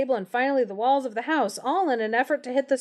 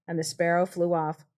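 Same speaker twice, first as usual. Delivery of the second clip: usual speaking voice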